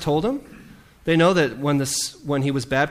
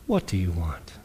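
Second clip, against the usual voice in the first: low wary tone; 7 semitones lower, 7 dB quieter than usual. Shown as a demonstration. Jesus eyeing the strangers behind him, low and guarded. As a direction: lower and softer, guarded question. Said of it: "Or was it".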